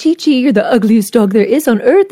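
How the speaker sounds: lightly sing-song sweet voice to a dog; sweet tone, mean words. Retold or imitated. Boys teasing the dog with insults, lightly sweet-toned. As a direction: coo the insult like praise. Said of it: "mean things in a nice tone".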